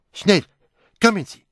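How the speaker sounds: high, loud alarmed shout; urgent shout. Acted. Someone shouting for help after a crash, high and loud. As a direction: yell for help at once.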